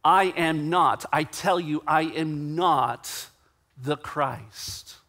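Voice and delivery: "I am not" slow and punched, word by word; slow, insistent "I am not", restated firmly; emphatic denial. Retold or imitated. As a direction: double down on "not", pausing before "the Christ". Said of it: "he answers very emphatically".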